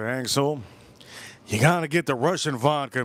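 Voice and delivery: usual speaking voice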